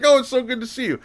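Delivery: high, sweet, overfriendly goodbye; gushing stranger. Retold or imitated. Woman leaving the store, high gushing farewell. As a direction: beam at him like a long-lost friend.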